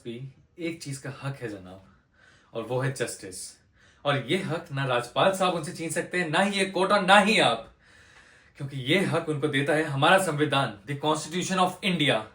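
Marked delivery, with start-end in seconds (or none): 4.0-12.2 louder, forceful declaration of a right; impassioned courtroom plea. Acted. Lawyer defending the footpath sleepers' right to justice, impassioned. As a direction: drive each point home to the whole court.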